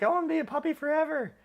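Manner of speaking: very high, fast mock voice of wife; high, quick, gushing mock quote; squeaky puppy-lover voice. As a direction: squeal it like you never want the puppy to grow. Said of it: "And she's like".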